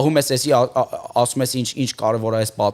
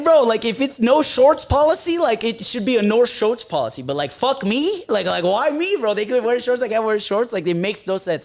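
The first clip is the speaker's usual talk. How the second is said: exasperated rant; 9 semitones higher than usual. Retold or imitated. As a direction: argue the unfairness, fed up. Ranting at guard about shorts policy.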